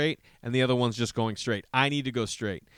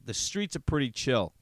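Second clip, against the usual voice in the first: ordinary talk, nothing marked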